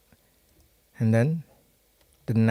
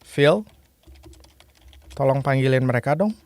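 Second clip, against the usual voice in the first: natural fast request; faster than usual. Shown as a demonstration. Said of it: "I will say this".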